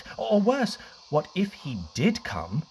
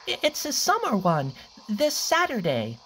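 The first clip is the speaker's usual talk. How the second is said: desperate fib; 10 semitones higher than usual. Acted. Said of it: "Cliff fibbed in great despair".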